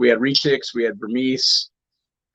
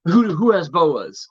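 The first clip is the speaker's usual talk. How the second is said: slow, frantic asking; 5 semitones higher, slower than usual. Retold or imitated. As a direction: play a frantic buyer.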